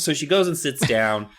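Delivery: ordinary talk, nothing marked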